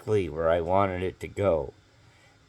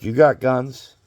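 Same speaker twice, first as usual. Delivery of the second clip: retold challenge; 6 semitones higher, 11 dB louder, slower than usual.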